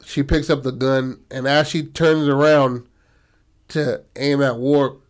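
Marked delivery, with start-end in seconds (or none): none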